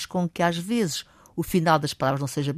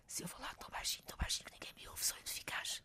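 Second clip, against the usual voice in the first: sussurro demonstrativo; 22 dB quieter, faster than usual. Shown as a demonstration. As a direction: whisper so softly nobody can hear. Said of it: "é o tom de voz". (sussurro quase inaudível)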